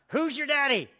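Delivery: high, playful "Who's your daddy?"; playful taunt. Acted. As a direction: tease the crowd with it. Preacher repeats phrase.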